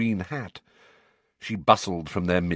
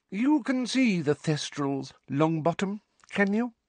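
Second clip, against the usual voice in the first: prim Umbridge; 12 semitones higher, 3 dB quieter than usual. A narrator's reading.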